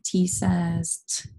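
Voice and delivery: slow "T says T", last T just a puff; name T, then give its bare sound; letter name, then sound. Shown as a demonstration. Teacher spelling away with pupils, "T says T" slowly, ending on the sound. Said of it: "Spell the word away with me".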